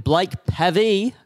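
"Pavey" said as PAV-ee, short a as in "have"; mispronounced surname. Retold or imitated. Radio host introduces the guest, mispronouncing "Pavey" as PAV-ee. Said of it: "Uh, it's Pavey".

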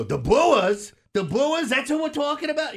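put-on loud, high-pitched baby-talk rant; Mad Dog impression. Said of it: "he had, like, the baby talk".

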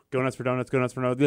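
rattled off fast, breaking into laughter; tongue-twister speed. Shown as a demonstration. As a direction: race through it like a tongue twister. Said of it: "five times fast".

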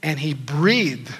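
slow, drawn-out "breathed"; slow emphasis. Shown as a demonstration. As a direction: stretch "he breathed" out slowly.

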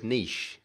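"niche" as neesh, soft sh ending; niche without T. Shown as a demonstration. Host correcting Americans, "niche" said neesh. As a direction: say "niche" with long ee and no t. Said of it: "There is no T in the word".

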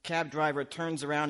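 ordinary talk

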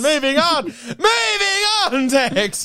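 high, laughing through the words; laughing as she tries to move on from Tex. Real, not acted. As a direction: crack up while moving on.

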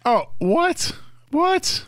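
high-pitched disbelieving "what?"; scandalized squeal of disbelief, rising; incredulous outburst. Real, not acted. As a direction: leap way up in pitch, appalled. Mock shock at [name] being insulted.

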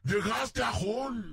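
slow, high, drawn-out shout of disbelief; hearing the guest's confession, stretched-out astonished cry; shocked exclamation. Real, not acted. Host repeats in disbelief.

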